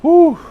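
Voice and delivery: short, high "woo"; overwhelmed whoop. Real, not acted. Preacher getting back to the text, overwhelmed whoop. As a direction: let out a brief whoop to recover.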